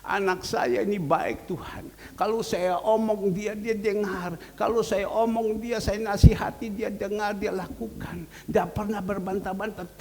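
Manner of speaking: high, gushing acted prayer; happy thanksgiving. Father thanking God for his obedient son, happy. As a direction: gratefully praise the child to God, acted. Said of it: "Papa pasti dengan senang langsung".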